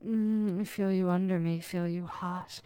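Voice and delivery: usual speaking voice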